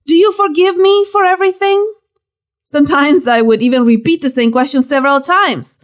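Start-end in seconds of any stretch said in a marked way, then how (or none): 0.1-1.8 restrained high-pitched calling out; mild narrated yell. A narrator's reading. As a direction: call out as if from another room, without much volume. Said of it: "I would yell once again from my bedroom".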